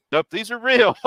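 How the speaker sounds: high, loud amazed verdict; surprised owner. Retold or imitated. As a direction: marvel at the real cards.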